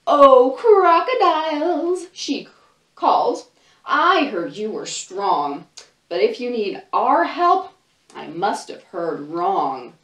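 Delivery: put-on lively read-aloud for little kids, voices and all; storybook reading. Librarian reading a picture book to children, animated storytelling.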